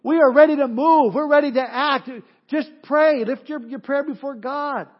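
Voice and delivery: loud and high, fed up and urging; exasperated angel yelling down from heaven; impatient angels shouting. Retold or imitated. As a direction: shout at them to just pray. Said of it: "the angels up in heaven going".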